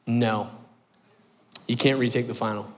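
low, soft, final no; teacher's quiet refusal. Retold or imitated.

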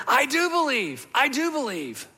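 high-pitched mild cry of belief; retold crying out. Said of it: "cried out and said".

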